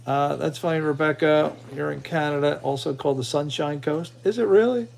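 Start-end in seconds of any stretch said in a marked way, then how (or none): none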